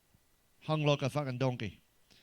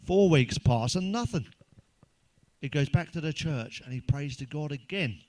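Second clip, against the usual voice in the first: usual speaking voice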